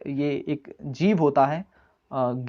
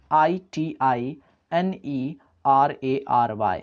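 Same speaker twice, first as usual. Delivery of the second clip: slow spelling; much slower than usual. Shown as a demonstration. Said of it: "spelling a word aloud".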